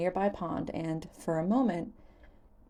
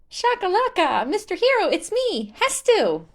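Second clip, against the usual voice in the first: very high, loud, bouncing cry; a giant kid dancing in place; joyful Hestu; 12 semitones higher, 8 dB louder than usual. Acted. Hestu introducing himself to Link, high joyful shout.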